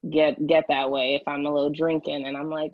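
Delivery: usual speaking voice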